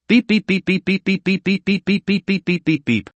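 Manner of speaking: soft high beeps like a dial tone; phone beeping. A narrator's reading. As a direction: mimic a phone ringing out. Phone ringing on the line.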